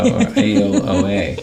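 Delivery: laughing imitation. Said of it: "She's like".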